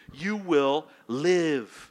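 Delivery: slow promise. Acted. God's promise quoted slowly.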